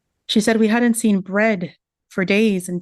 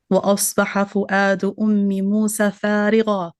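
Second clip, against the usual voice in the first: Quran recitation; faster than usual. Acted. Recites the verse about Umm Musa.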